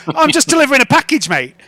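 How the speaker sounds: quick, high, loud protest; protesting delivery driver. Acted. Driver explaining himself to an angry shopkeeper, protesting.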